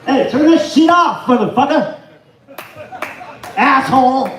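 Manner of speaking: loud, high-pitched yell; angry shout. Real, not acted. Comic breaks off the joke to curse someone out, angry shout. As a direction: yell it out, cursing.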